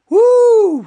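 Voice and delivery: loud high whoop; excited whoop. Real, not acted. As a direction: cheer with a big high woo. Cheer at race moment.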